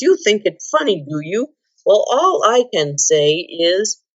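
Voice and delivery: ordinary delivery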